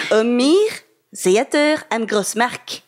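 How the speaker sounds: hammed-up German accent, drawn out. Shown as a demonstration. Host reads the Hamburg theatre's name for listeners, mock German accent. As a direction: put on your best shaky German accent, slowly. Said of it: "vous allez avoir mon accent allemand".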